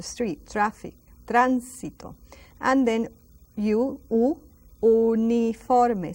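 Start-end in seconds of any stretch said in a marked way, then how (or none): 4.8-6.1 uniforme, slow, U said as a pure oo; Spanish U like oo. Shown as a demonstration. Teacher showing the Spanish U: uniforme. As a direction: draw out uniforme with an oo sound. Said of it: "See how I pronounce that U?".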